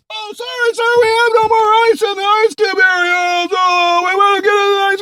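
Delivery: very high, sustained comic wail; whining assistant. Acted. Flustered assistant apologizes to boss over ice, high-pitched whine.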